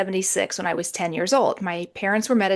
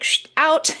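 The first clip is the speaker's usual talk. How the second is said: higher-pitched imagined brush-off; mock dismissal; 8 semitones higher than usual. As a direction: playfully shoo someone out, pitched up. Imagined sceptical audience dismissing her.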